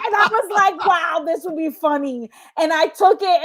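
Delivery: high, giggly retelling; laughing. Real, not acted. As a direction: keep laughing through the story. Still laughing while telling it.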